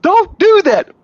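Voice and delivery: mock scolding wife. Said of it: "stuff like that".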